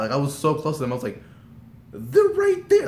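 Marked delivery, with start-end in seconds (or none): 1.9-2.9 high-pitched, louder fangirl squeal; reliving a starstruck sighting in a high squeal; excited squeal. Retold or imitated.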